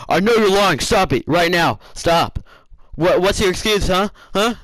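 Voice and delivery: loud, high-pitched scolding; imitated angry teacher. Teacher demanding an excuse from a student, imitated angry scolding.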